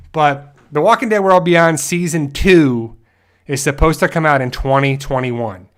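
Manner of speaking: ordinary talk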